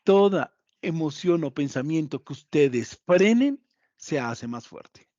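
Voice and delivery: se hace más fuerte dropped and slowed; teacher hammering the point home; repeated slow emphasis. Shown as a demonstration. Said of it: "Escuchen esto, se los quiero repetir".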